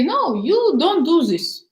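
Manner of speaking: high, slow scolding; mock complaining. Wife scolding her husband on the phone, slow and high. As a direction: drag it out like a nagging wife.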